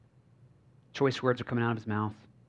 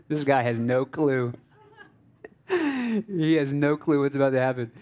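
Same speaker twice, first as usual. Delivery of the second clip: laughing in disbelief between phrases; amused laughing; 4 dB louder than usual. Real, not acted. Preacher telling congregation about the unsuspecting guy, amused laughing. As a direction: break into laughter around the words.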